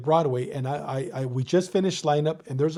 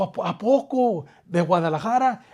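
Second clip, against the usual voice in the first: surprised in Spanish; 4 semitones higher than usual. Retold or imitated. Retold surprised reply in Spanish.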